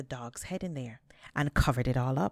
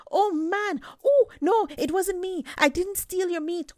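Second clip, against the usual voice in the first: high, loud fake wailing plea; crying out; 6 dB louder than usual. A narrator's reading. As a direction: howl it out as if beaten. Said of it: "he started to cry out".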